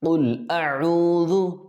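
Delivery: "قل" with a thick, back q, slow and high; heavy qaf. Shown as a demonstration. Teacher demonstrates thick qaf in slowly recited "قل". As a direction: recite "قل اعوذ" slowly, making the ق full and heavy. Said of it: "اس کو موٹا کریں گے".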